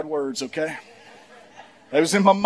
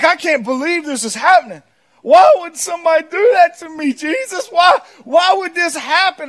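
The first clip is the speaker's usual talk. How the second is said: whining and complaining; 10 semitones higher, 6 dB louder than usual. Acted. Preacher acting out his complaint to Jesus, whiny. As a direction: whine up high as if wronged and baffled. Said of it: "when I'm whining and complaining".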